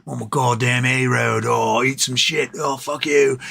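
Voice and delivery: cocky tavern thug voice; the A road cursing at the other roads in the pub, cocky bully voice; drunk bully A road. Retold or imitated. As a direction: sneer it like a bar bully mouthing off. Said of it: "He's like".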